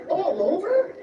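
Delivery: high and slow, wide-eyed, laughing through it; high Superman voice. Acted. Hammed-up reading of Superman talking to Neil, high and slow with laughter. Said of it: "And then Superman says".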